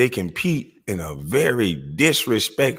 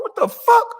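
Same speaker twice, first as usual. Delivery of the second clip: panicked burst; alarmed. Retold or imitated. Alarmed, thinks break-in.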